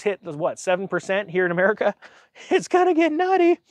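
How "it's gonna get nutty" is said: much higher, gleeful squeal; high giddy exclamation; excited. Real, not acted. Laughing about 7% mortgages.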